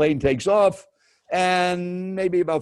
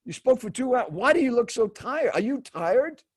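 high-pitched mock disbelief; imitated incredulous questioning; 8 semitones higher than usual. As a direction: grill someone as the baffled seatmate. Acted stranger's incredulous questioning.

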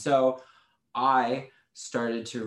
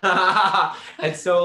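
bursts out laughing, loud and high; 10 semitones higher, 12 dB louder than usual. Real, not acted. Cracking up at her cohost's sorceress joke, laughing loudly. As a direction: laugh through the words.

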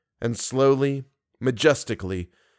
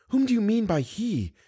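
much higher, anxious woman's question; changed voice; 10 semitones higher, 3 dB quieter than usual. Acted. Woman blocks Raoul at the door, in changed voice. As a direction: ask it high, without raising the volume. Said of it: "she asked in a changed voice".

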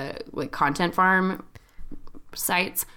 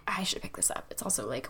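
hushed inner thought, barely voiced; whispered thought; 11 dB quieter than usual. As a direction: whisper it to yourself as if deciding. Retold thought about answering the call.

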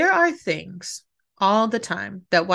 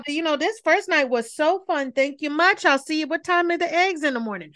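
fake polite exit; 8 semitones higher than usual. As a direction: chirp a fake-friendly farewell. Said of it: "I would say".

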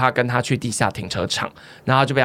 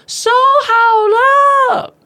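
very high, louder, stretched-out shout; loud slow yell back; 6 dB louder, much slower than usual. Retold or imitated. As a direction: kids yell back so mom hears. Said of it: "用更大声音声音说". (孩子们拉长声音大喊回答)

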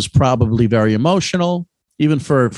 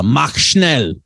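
German quote; 3 semitones higher than usual. Retold or imitated. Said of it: "he probably said something to the effect of".

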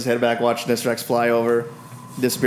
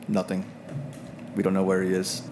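low, subdued grumble; low grumbling voice; 4 semitones lower, 8 dB quieter than usual. Acted. Harshnag admitting failure to the Oracle, low grumbling voice. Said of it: "a low, grumbling voice goes".

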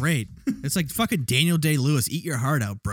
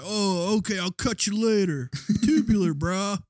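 surfer dude; 7 semitones higher than usual. Retold or imitated. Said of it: "he's like".

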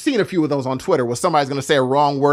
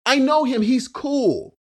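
defensive friend quote; 6 semitones higher than usual. Retold or imitated. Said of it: "my friend was like".

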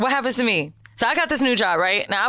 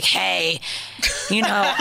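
lightly voiced creepy caller; a hint of a creep leaving a message; creepy voicemail caller; much slower than usual. Man leaving coworker a voicemail, mildly creepy. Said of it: "I swear that's how it sounded".

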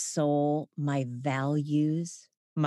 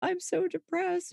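much higher, mocking mopey voice; mock gloom; 14 semitones higher than usual. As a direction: parody a sad sack. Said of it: "it's never like".

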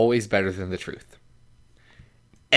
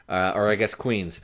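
ordinary talk, nothing marked

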